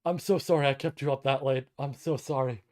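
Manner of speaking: normal talking voice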